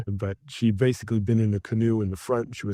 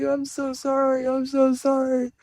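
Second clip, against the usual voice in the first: high, pleading mock apology, slower; flustered apology. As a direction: imitate a frightened tourist begging pardon. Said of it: "she's like".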